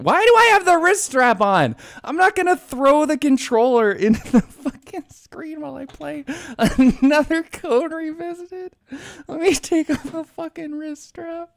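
high-pitched exasperated ranting; incredulous squeal. Real, not acted. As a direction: squeak with mock outrage. Mock outrage at own wrist strap.

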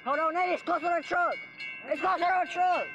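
high urgent directions; urgent shouting. Real, not acted. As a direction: yell directions in a hurry. Fleeing from a train.

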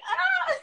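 high fangirl "Ah!" squeal; retold squeal. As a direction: squeal like you just spotted him. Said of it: "I was like".

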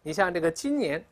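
ordinary talk

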